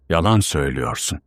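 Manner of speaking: usual speaking voice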